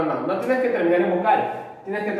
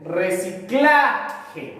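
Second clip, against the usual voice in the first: Reciclaje drawn out very slowly, leaning on CLA; stress on reciclaje's CLA; 2 semitones lower, much slower than usual. Shown as a demonstration. Teacher sounding out a word for stress rules, Reciclaje with CLA held. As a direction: stretch Reciclaje, holding CLA before a short je.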